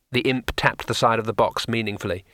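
ordinary delivery, nothing marked

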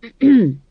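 loud, pointed clearing of the throat; meaningful throat clearing. A narrator's reading. Granny interrupts to get attention, meaningful throat clearing. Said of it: "cleared her throat meaningfully".

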